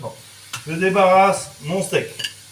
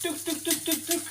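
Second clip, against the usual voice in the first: tong clicks; 12 semitones higher, 10 dB quieter than usual. Shown as a demonstration. Clicking the tongs.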